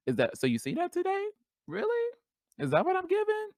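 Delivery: high-pitched, softened disbelief; incredulous surprise. Acted. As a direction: ask it way up high, amazed. Acted startled reaction to being gendered correctly.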